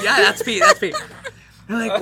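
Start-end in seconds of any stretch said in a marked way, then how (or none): 0.0-0.9 louder, leaping up high with a laugh; the second one cracks up high; laughing realization. Retold or imitated. Inspecting the friend's side, laughing disbelief. Retold confirming the pee.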